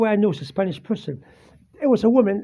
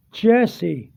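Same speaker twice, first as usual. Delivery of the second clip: ordinary talk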